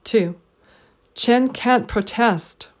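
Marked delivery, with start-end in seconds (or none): 1.1-2.7 Chen, can't, test stressed slowly, pro unstressed; slow Chen can't protest with a weak pro; deliberate three-beat Chen can't protest; three stresses, one weak. Shown as a demonstration. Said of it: "three stressed syllables and one unstressed syllable".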